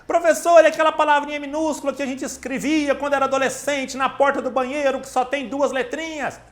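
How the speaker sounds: high-pitched student question in one rush; aluno perguntando. Acted. Imita pergunta de aluno ao professor. (voz aguda de aluno perguntando)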